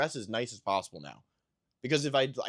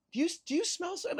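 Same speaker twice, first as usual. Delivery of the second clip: much higher, quick mock question; imitating wife; much faster than usual. Husband mocking his wife's constant sniffing, high fast mock voice. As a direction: rattle it off in a squeaky imitation. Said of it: "she'll be like".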